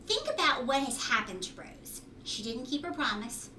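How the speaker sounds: usual speaking voice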